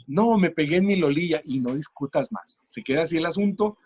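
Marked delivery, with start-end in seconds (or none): 0.1-1.4 voz aniñada, "lolilla" en lugar de rodilla; niño que no sabe decir rodilla. Retold or imitated. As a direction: haz de niño pequeño que insiste con "lolilla". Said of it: "el niño dice". (imitando a un niño que dice "lolilla")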